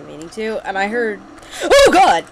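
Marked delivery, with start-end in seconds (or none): 1.6-2.2 sudden loud, high yelp; startled scream. Real, not acted. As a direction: cry out in sudden fright. Startled by creature while talking.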